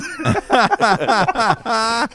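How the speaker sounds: high-pitched run of laughter. Real, not acted. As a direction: crack up at your own confession. Laughs at his own story.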